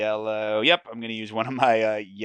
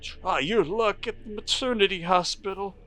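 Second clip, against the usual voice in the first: sobbing man's voice; 9 semitones higher than usual. Acted. Weeping man points the way.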